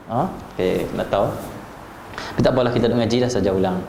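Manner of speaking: normal talking voice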